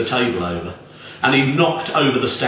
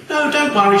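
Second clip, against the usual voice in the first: put-on chirpy old lady; 5 dB louder than usual. A narrator's reading. Old lady reassures, high voice.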